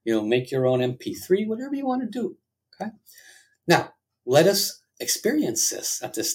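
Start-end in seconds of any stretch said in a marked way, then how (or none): none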